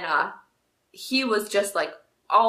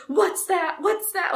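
high, jumpy questions; nervous little kid on edge; all nervous; 10 semitones higher, slower than usual. Retold or imitated. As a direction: imitate an anxious child startled by noises. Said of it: "Like, all nervous".

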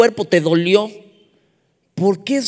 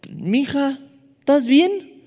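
gentle concern; 9 semitones higher, much slower than usual. Retold or imitated. Asking crying girl on metro.

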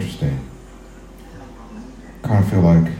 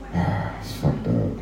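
muttered sigh; 5 semitones lower, 8 dB quieter, slower than usual. Real, not acted. Sighing aside after outburst.

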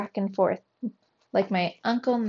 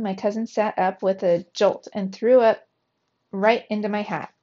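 ordinary delivery, nothing marked

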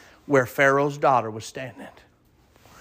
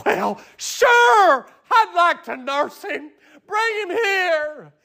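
high crying shout of relief, slowing at the end; overjoyed mother through tears; tearful joy; much slower than usual. Acted.

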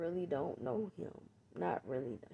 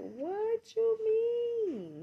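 high, loud, drawn-out what you mean; disbelief; 9 dB louder, much slower than usual. Retold or imitated. Thinking at the ex in the store, slow high outraged disbelief. Retelling thought at the ex.

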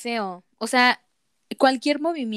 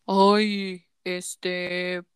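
lightly mocking sleazy guy; 4 semitones lower, much slower than usual. Mocking a typical creepy man.

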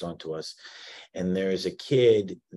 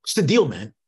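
louder, high-pitched retold challenge; confrontational call-out; 9 semitones higher, 6 dB louder than usual. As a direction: call the kids out, acting out an irritated friend. Acted confrontation with laughing kids.

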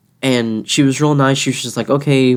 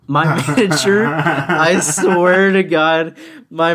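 real laughter breaking into the words; laughing; 4 semitones higher, much slower than usual. Real, not acted. Cashier telling friend about manager's comeback, laughing. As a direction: talk through a laugh, drawn out.

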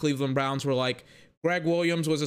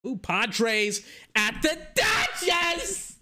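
excited shout; 10 semitones higher, much slower than usual. Real, not acted. Spots a game he wants.

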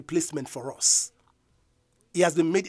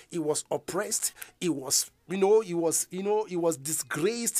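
normal talking voice

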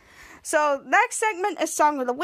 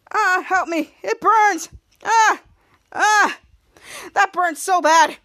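loud mock cries of agony; dramatic pain; 3 semitones higher, 5 dB louder, slower than usual. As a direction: overact a burning sting, yelling. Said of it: "[name] being so dramatic".

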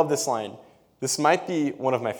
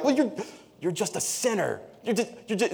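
much higher, sputtering mock accusation; flustered Pharisees; 8 semitones higher than usual. Pharisees with no answer for the blind man, high sputtering. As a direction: sputter like someone out of arguments. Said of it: "they get to this point where they're like".

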